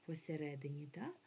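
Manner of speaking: usual speaking voice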